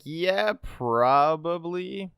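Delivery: high, slow, stretched-out mock answer; drawn-out concession. Answering players who complain about crossplay advantage, drawn-out concession. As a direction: stretch it out high, as if stating the obvious. Acted reply to retold complaints.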